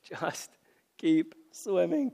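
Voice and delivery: a lightly put-on cartoonish voice, rising at the end; playful sing-song. Shown as a demonstration. Preacher repeating the motto in a playful put-on voice, lightly sung. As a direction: toss the motto off playfully, ending on a lift. Second take, altered voice.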